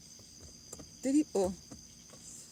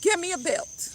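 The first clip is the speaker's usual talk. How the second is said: higher, sharp imitation of mom; acted maternal command; angry mother; 7 semitones higher, 4 dB louder than usual. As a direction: call out the order like a fed-up mother. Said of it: "And then she'll be like".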